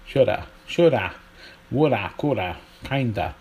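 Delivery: exaggerated American "shoulda" and "kinda"; American accent. Shown as a demonstration. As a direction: put on a mock American accent. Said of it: "my accent very offensive".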